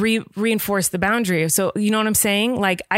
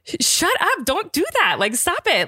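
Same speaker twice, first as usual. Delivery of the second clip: mock exasperated snap; 9 semitones higher than usual. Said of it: "You wanna just be like".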